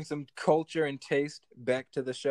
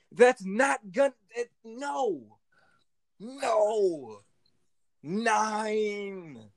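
high, stretched-out no, falling at the end; exasperated, drawn-out denial; emphatic refusal; 4 semitones higher, much slower than usual. Real, not acted. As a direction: refuse it in long, swooping words. Emphatic refusal, mocking the TikTok posters.

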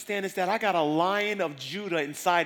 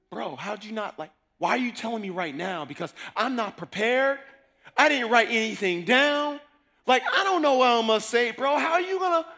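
panicky; 8 semitones higher, 7 dB louder than usual. Retold or imitated. Panicked reply to sudden preaching.

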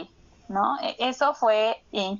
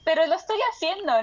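higher, quick mock protest; protesta imitada; 7 semitones higher, faster than usual. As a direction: object in a raised, hurried voice. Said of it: "es así como de". (protesta imitada, aguda y rápida)

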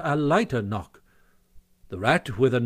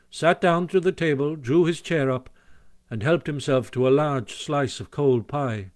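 ordinary delivery

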